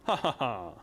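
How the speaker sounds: hammed-up ha ha ha; mocking laugh. Sidekick laughing at the merchant, hammed-up laugh. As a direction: laugh at him in character.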